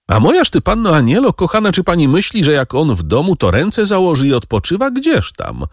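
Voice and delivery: mother laughing off the idea; cheerful exclaim. A narrator's reading. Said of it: "zawołała Dęboszowa wesoło".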